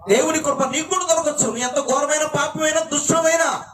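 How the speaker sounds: high, rising preacher's cry; impassioned preaching. Acted. Preaching at full force.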